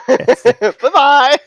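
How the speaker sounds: high, loud laughing bye-bye. Real, not acted. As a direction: call out through laughter. Laughing taunt to stone.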